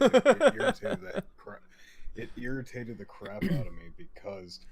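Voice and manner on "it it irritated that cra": laughing mid-sentence; words breaking into a laugh. Real, not acted. Laughs while complaining about bots.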